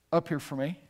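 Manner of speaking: usual speaking voice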